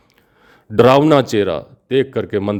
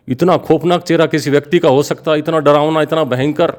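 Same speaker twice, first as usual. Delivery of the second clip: higher, awed horror; horrified; 7 semitones higher than usual. A narrator's reading. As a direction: dwell on the dread of it. King's horror at the face.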